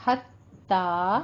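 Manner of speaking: "हत्ता" slow, final aa held open; drawn-out last vowel on "हत्ता"; two-count long vowel. Shown as a demonstration. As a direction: open the mouth and stretch the end of "हत्ता". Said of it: "आवाज को टू count करना है".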